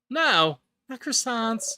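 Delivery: high, loud mock horror; dismayed cry. Friend hears the croissant wish, mock-horrified shout. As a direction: cry out as if the worst happened.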